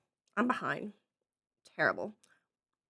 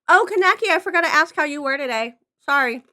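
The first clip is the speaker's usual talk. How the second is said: excited apology; 6 semitones higher, 9 dB louder than usual. Real, not acted. Sudden apology to chatter.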